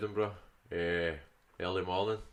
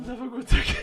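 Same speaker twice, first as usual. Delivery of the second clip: laughing; 10 dB louder than usual. Real, not acted. Laughing while retelling the story.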